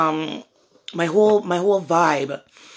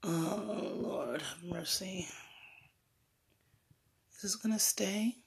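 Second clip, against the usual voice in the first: whisper; 16 dB quieter than usual. Real, not acted. Whispered question to phone.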